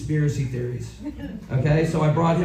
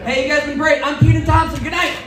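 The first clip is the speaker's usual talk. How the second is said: higher, louder announcer farewell; host sign-off; 10 semitones higher, 6 dB louder than usual. Real, not acted. Host signing off to the crowd, loud announcer voice. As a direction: boom out the sign-off like a show host.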